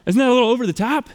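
teen player to coach, mock disbelief; incredulous teen. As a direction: push back like a cocky eighteen-year-old. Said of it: "I'm like".

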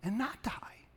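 breathy whisper on the last words. Acted. Pastor hushing the promise to the congregation, whispered. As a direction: drop to a whisper for emphasis. Hushed close of scripture line.

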